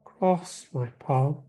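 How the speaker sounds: very slow, ominous seer voice; fortune teller. Acted. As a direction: linger on each word like a crone. Fortune teller asks for payment.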